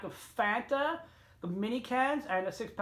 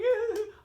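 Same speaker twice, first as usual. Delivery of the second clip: high-pitched giggling burst; real high laugh; 14 semitones higher, 5 dB louder than usual. Real, not acted. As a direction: laugh out in disbelief. Laughs at the toothless couple.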